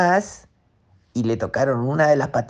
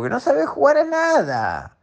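high character voice; 11 semitones higher than usual. Acted. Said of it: "dijo el cangrejo".

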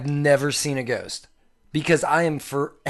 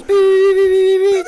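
news beeps; 8 dB louder than usual. Pretend.

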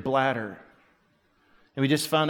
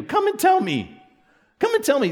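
much higher, insistent invitation; pleading high urge; 14 semitones higher than usual. Real, not acted. Pastor urging the congregation to be honest, pleading high. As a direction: call them to come and talk.

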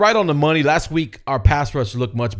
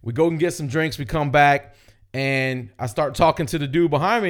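ordinary talk, nothing marked

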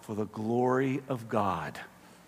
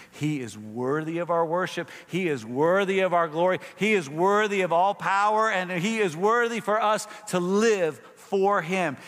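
building louder and higher with each line; rising praise; 5 semitones higher, 4 dB louder than usual. Acted. Pastor proclaims God's worth, building to a loud climax. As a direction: climb to a proclamation.

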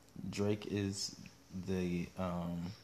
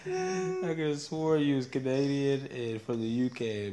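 louder put-on island lilt; hammed-up accent, half laughing; mock Caribbean accent; 6 semitones higher, 8 dB louder than usual. Host teasing his partner in a mock accent. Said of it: "you sound Jamaican".

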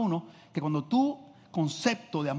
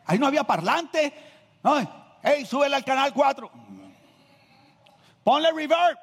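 higher, animated preaching voice; heightened preaching; 11 semitones higher, 6 dB louder than usual. Acted. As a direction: keep the pulpit energy going. (predicación animada)

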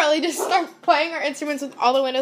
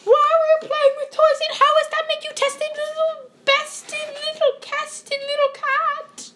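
high, sing-song rhyme chant; 10 semitones higher than usual. Pretend. Interrogating a suspect in rhyme, high voice. High-voice rhyming game continues.

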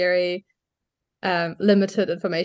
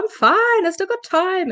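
much higher, light and brushing it off; mock-carefree reassurance, pitched way up; airy "I'm fine" in a high sing-song; breezy dismissal; 11 semitones higher than usual. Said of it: "I was kind of just like".

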